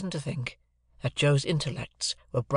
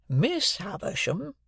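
slow, higher, drawn-out name; effortful remembering; 7 semitones higher, slower than usual. A narrator's reading. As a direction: dredge it up from memory. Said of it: "like an effort of remembrance".

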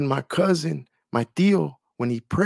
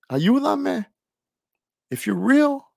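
higher, pleading cry for help; desperate plea; 8 semitones higher than usual. Retold or imitated. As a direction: call out to God, on the edge of tears. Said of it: "I kept saying".

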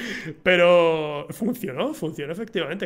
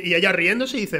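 ordinary delivery

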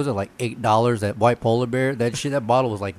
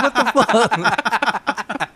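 drawn-out disbelief; 11 semitones higher, 3 dB louder, much slower than usual. Retold or imitated. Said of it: "I'm like".

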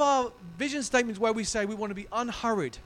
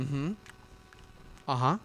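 poker-faced; 5 semitones lower, 3 dB quieter than usual. Retold or imitated. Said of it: "poker face Billy".

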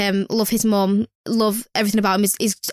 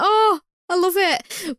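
touched; 8 semitones higher, much slower than usual. Retold or imitated. Touched by card.

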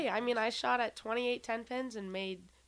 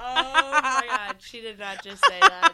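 loud, laughing disbelief; shocked laughter; 3 semitones higher, 13 dB louder, slower than usual. Real, not acted. Reacts to Michael Jordan claim.

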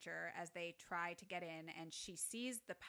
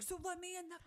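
mock yell; 10 semitones higher, faster than usual. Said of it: "He's like".

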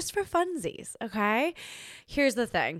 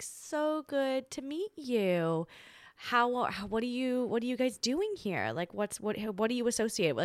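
fake polite; 4 semitones higher, 5 dB quieter than usual. Overly polite small talk.

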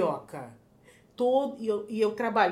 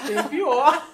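laughing tease; 5 semitones higher, 12 dB louder than usual. Real, not acted. Teasing there's worse slang.

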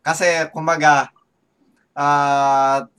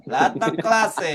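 slow, higher, drawn-out hawker's cry; vendor call; 5 semitones higher, slower than usual. Pretend. Advertising every kind of goods, mock vendor call. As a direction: stretch it like a mock street seller. Acting out a market vendor's recorded calls.

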